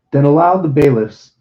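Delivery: plain reading voice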